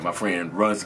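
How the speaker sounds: usual speaking voice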